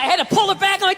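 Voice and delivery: ordinary delivery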